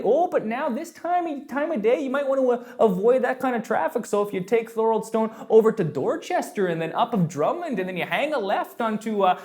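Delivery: put-on high, lilting Canadian accent; hammed-up Canadian accent, pitched well up; Canadian dad voice. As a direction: play dad rattling off directions in Canadian. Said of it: "I gotta get the Canadian voice on".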